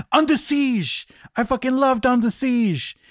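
excited imitation of a French actor's accent; bad French accent. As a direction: gush about the film in a hammed-up French accent. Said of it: "excuse the bad French accent".

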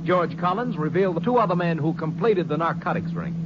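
ordinary talk

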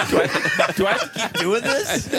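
high voice, laughing through the words; laughing Andy voice. Retold or imitated. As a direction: whine it while laughing. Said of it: "it's like the Andy on The Office".

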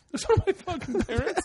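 high-pitched mock indignation; retold incredulity. Friend scoffing at comic's mistake, retold incredulity. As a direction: throw the assumption back, voice way up.

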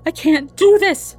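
high, loud, strained protest; distressed young woman's cry; desperate. A narrator's reading. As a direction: despair in the middle of labor. Woman overwhelmed by labor pain.

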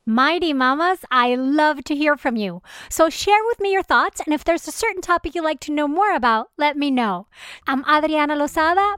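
bright host outro read; podcast outro. Acted.

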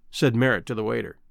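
ordinary delivery, nothing marked

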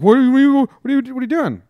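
high, loud shocked "what are you doing"; a startled girl shrieking. Retold or imitated. Girl reacting to him walking away, high loud and shocked. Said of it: "And she's like".

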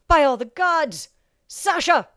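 loud, high, slow outcry of shock. A narrator's reading. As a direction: cry out her name in disbelief. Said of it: "Karl's outcry filled the chamber".

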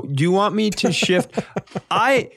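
normal talking voice

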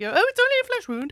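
high, quick comic film-quote voice; Monty Python quote. Acted. Fan quoting Monty Python and the Holy Grail, high comic voice. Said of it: "Monty Python and the Holy Grail".

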